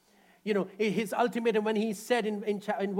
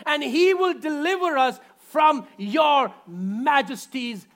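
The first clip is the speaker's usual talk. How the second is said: loud, higher, defiant cry; defiant shout; 5 semitones higher, 7 dB louder, slower than usual. Acted. Young men defying the king, shouting. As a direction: throw it at the king with confidence. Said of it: "This was their confidence".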